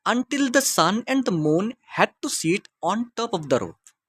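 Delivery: slow, measured classroom story reading; deliberate teacher's reading pace; slow read-aloud. Acted. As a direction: read it out slowly, phrase by phrase for learners. Reads the story line aloud.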